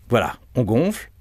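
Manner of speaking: normal talking voice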